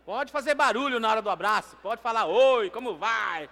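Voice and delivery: high, full-force holler to the room; rally the congregation over the hubbub; loud, rousing shout; calling out loudly. Real, not acted. Calls over congregation hugging.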